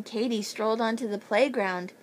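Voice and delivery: plain reading voice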